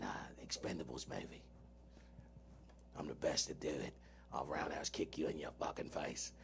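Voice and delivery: low, soft mock British gangster voice; Jason Statham impression. Doing impressions for his cohost, a low mock Statham. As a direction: drop way down into a quiet Cockney growl. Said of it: "I can do Jason Statham".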